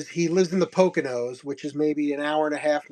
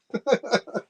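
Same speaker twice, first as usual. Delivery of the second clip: laughing; 6 semitones higher than usual. Real, not acted. Laughing at his own offer.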